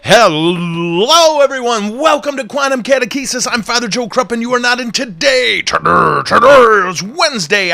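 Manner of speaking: high, loud hammed-up host intro; show opening announcer. Priest opening his live show, big high-pitched announcer delivery. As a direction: stretch the hello and belt out the welcome.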